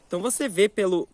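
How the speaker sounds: ordinary talk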